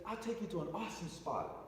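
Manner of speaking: lightly sketch the Jamaican driver's voice; light Jamaican accent. Retold or imitated. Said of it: "He said in Jamaican".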